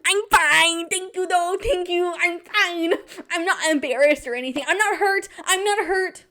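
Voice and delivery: high, loud, half-crying insistence; mock brave. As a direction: pretend you're fine while near tears. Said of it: "And I'm like".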